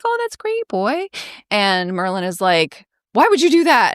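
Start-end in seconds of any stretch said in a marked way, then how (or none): 0.0-1.1 sarcastic owl. Retold or imitated. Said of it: "Archimedes is like". 3.1-3.9 higher, louder frustrated yell; exasperated yell. Retold or imitated. Merlin yelling at Wirt, a loud frustrated mock yell. Kinda yells at him.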